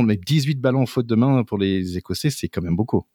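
ordinary talk

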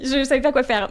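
words carried on a laugh. Real, not acted. Guest admitting she had no purpose, laughing. Laughing at her own aimlessness.

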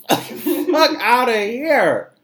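slow, laughing dismissal. Real, not acted. Host laughing off the rioters, slow and loud. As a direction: laugh it out in disbelief.

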